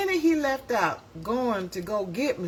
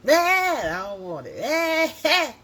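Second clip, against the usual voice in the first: loud, drawn-out pretend wail; mock baby tantrum; 9 semitones higher, 5 dB louder, slower than usual. Baby refusing the spoon of other food, mock tantrum. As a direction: cut up like a fussy baby, playfully. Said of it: "you cut up".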